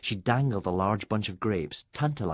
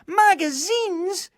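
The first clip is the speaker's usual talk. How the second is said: very high, slow delighted squeal; excited exclamation; 7 dB louder, much slower than usual. A narrator's reading. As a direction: stretch it out in thrilled delight. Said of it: "exclaimed the three girls".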